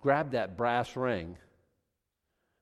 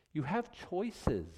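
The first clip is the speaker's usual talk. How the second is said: usual speaking voice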